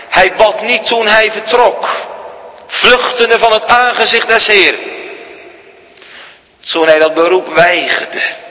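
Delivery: high, ringing pulpit voice, slowed on the opening; sermon delivery at full force; full-force preaching. Acted. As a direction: proclaim it from the pulpit. Preaching raised.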